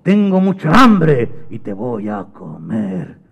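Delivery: slow, stretched, menacing monster voice; giant monster. Acted. Monster waking Mateo to eat him, menacing and slow. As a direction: boom it out slowly as a monster. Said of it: "volvió a salir aquel monstruo".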